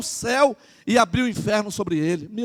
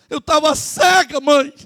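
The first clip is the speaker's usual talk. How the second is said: higher, louder and slow cry of regret; anguished confession; 6 semitones higher, 5 dB louder, slower than usual. Retold or imitated. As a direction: admit you were blind, voice rising. Daughter confesses to her mother. (filha lamentando alto e devagar: eu tava cega)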